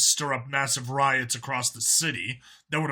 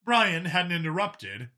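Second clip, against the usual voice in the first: plain reading voice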